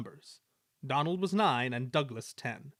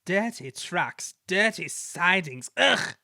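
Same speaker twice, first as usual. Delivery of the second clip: higher, slow, louder in disgust; disgusted James; 6 semitones higher, 6 dB louder, slower than usual. A narrator's reading. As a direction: turn your nose up at it. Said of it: "put in James".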